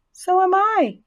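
very high, held little voice; tiny voice. A narrator's reading. Second spiderling announces herself to Wilbur, tiny high voice. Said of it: "said another tiny voice".

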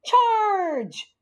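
high, drawn-out charge yell; storybook war cry, pitched high; yelled battle cry. A narrator's reading. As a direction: yell it like leading an attack.

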